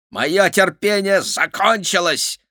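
high, slow villain growl. A narrator's reading. Gavint runs out of patience, slow growled threat. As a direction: lose patience with menace. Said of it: "прорычал Гавинт".

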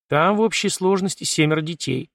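plain reading voice

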